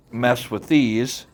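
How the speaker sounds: ordinary talk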